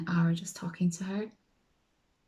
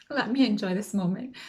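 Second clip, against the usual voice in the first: lightly acted inner indulgence; savouring the moment; 3 semitones higher, 3 dB louder than usual. Guest deciding to go along with it, savouring the moment. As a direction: play along, relishing the attention. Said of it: "then I was just like".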